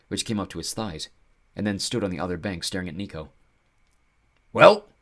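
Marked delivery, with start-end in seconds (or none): 4.5-4.9 sharp impatient high question. Acted. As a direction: demand an answer, impatient. Centaur waits impatiently.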